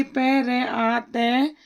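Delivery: very slow letter-by-letter: पै रे आ तै है; sounding out letters. Shown as a demonstration. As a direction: spell it out slowly, each sound apart. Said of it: "आगे देखिए".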